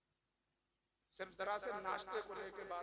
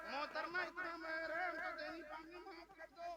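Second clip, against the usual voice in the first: a hint of a meek groom pleading, softer; mock begging groom; 6 semitones higher, 3 dB quieter than usual. Groom mockingly asks his bride to waive mahr, meek. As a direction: lightly play the husband begging off the dowry. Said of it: "ऐसा नहीं होता है".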